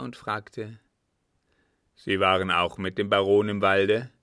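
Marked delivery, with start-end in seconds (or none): 1.9-4.1 loud, stern captain; interrogating captain. A narrator's reading. Captain questions the girl's father, stern. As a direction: demand an answer loudly.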